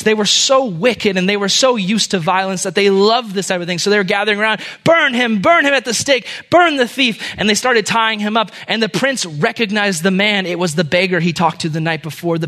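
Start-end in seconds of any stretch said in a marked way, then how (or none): 4.8-7.3 high, raised chant of a mob; baying crowd. Acted. Crowd gathering round the stake calls for the thief's death, baying. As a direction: call it out high and loud like a mob.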